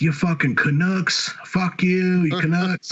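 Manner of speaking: high-pitched mock yell of taunts; Jesse yelling. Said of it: "he's yelling this".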